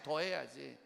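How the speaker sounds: normal talking voice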